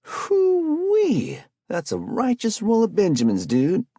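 high whooping, loud and amazed; impressed whistle. A narrator's reading. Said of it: "Spike lets out a low whistle".

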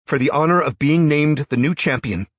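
loud, high proclamation; loud declaration. A narrator's reading. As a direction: declare it to everyone. Jarl's public challenge continues.

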